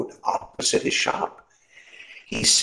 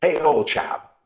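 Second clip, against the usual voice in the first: calling out; 11 semitones higher, 4 dB louder than usual. Acted. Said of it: "he called from the doorway".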